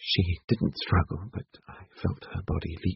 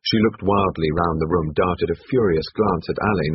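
usual speaking voice

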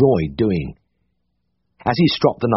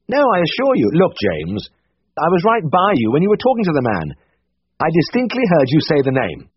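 higher brisk voice for Siegfried; Siegfried's voice, higher and brisk; 8 semitones higher than usual. A narrator's reading. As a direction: voice the brisk senior vet. Siegfried's voice held in reading.

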